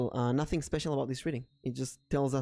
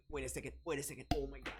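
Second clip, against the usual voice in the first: much softer, hushed "wait a second"; hushed alarm; 12 dB quieter, faster than usual. Real, not acted. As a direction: drop to a hush while spotting it. Spotting a mosquito.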